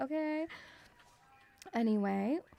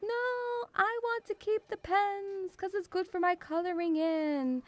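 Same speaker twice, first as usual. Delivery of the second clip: put-on higher pleading kid voice; child begging; 6 semitones higher than usual. Young self begging grandma for the pens, high whine. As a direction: whine like a little girl after the pens.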